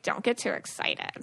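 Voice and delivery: whispered, a hint of a knowing sister; sister's warning whisper. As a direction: breathe the warning like a secret. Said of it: "my sister being like".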